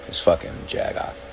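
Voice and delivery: whispered angry insult under the breath; real irritated whisper aside; muttered curse. Real, not acted. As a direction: hiss it to yourself, annoyed. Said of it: "I felt some anger".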